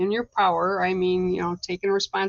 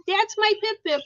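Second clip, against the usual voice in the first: higher cooing with stress on "my"; pet talk; 12 semitones higher than usual. Real, not acted. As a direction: coo "my" lovingly to your pet bird. Cooing at bird Pip.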